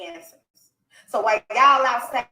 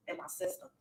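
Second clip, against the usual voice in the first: whispered echo; 3 semitones lower, 14 dB quieter than usual. Real, not acted. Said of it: "whispered echo of line".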